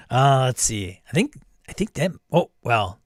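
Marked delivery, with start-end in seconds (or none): none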